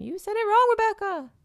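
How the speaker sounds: high, loud imitated complaint; mock scolding. Viewers correcting the speaker, high mock scolding.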